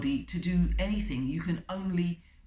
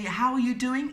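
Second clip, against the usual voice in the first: higher, louder mock salesperson hello; overfriendly salesperson; 6 semitones higher, 5 dB louder than usual. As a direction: play the over-familiar cold caller.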